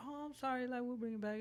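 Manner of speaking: high sweet apologetic waiter; polite waiter. Retold or imitated. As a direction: chirp the apology. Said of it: "They'd be like".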